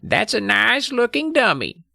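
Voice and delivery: put-on high old lady voice; quietly polite old lady; old woman's voice. A narrator's reading. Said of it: "Missus Miller said quietly".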